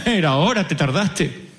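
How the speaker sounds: mock impatient caller. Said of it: "y dijo".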